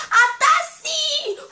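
high, slow drawn-out shout of the name; excited reveal. Real, not acted. Vlogger revealing her idol to viewers, thrilled. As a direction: announce her name like a prize. Big reveal of who she met.

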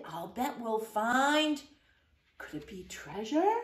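plain reading voice

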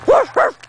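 high playful woof woof; barking. Pretend. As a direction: bark like a little dog. Barking back at dog.

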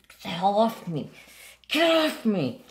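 high-pitched "get off me" at a pet; retold yelling. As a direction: shoo the cat off your chest, half yelling. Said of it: "And I start yelling at him".

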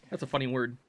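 ordinary delivery

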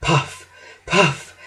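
breath-heavy puffs; panting. A narrator's reading. Thomas too out of breath to speak, panting. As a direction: puff it out like a winded engine. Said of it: "panted poor Thomas".

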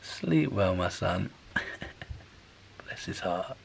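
soft low hush. Real, not acted. Dad speaking softly beside his sleeping son. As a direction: keep it down so he sleeps. Baby fell asleep.

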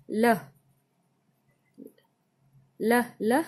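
"ल" as separate short luhs; phonic L sound. Shown as a demonstration. Teacher showing the sound of L, "ल" sounded out. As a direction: sound out each "ल" on its own. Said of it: "एल का sound होता है".

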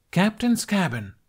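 high, slow proud announcement; announced. A narrator's reading. Kamikaze showing Hiccup her cabin, proudly announcing. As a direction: present the cabin grandly. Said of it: "she announced".

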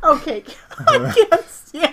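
words broken up by real laughter; laughing hard. Real, not acted. Co-host laughing at the Eeyore voice. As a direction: barely get it out through laughing.

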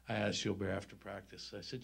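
ordinary talk

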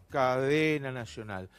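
low, drawn-out mock-solemn refrain. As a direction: drop low and intone it like a tired punchline. Mocking refrain after each example.

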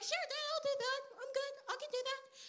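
put-on high-pitched boy's voice; eager young David. Young David agreeing to his dad's errand, high and boyish.

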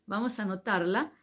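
ordinary talk, nothing marked

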